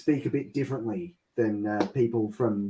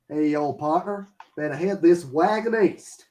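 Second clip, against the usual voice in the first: cowboy Texan; 5 semitones higher than usual. Retold or imitated. Mock Texan cowboy line.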